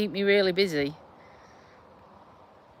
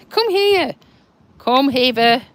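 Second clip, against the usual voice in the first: high, louder call to come back; bright louder call to a pet; calling the dog; 5 semitones higher, 8 dB louder, slower than usual. Acted. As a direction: call the little dog over cheerfully.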